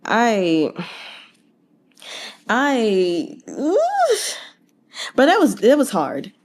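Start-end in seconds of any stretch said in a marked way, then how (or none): 3.5-4.4 sharp high pained oof; like the news just punched you; a stung high-pitched oof. Real, not acted. Emotional reaction to character's death.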